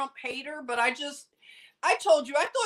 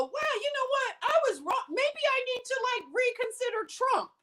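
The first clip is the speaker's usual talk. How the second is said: high-pitched musing to friends; mock realization; 10 semitones higher than usual. Telling friends she may reconsider Trump, high-voiced mock realization. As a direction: pitch it way up, wondering aloud.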